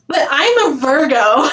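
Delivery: laughing through the punchline; can't keep a straight face; laughing quote. Retold or imitated. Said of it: "And then she goes".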